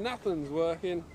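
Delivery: usual speaking voice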